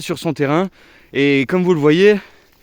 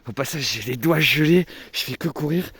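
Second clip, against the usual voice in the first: out of breath, quiet and worn out; breathless after running in the cold; icy wind gasps; 3 semitones lower, 6 dB quieter than usual. Real, not acted. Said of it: "j'en peux plus".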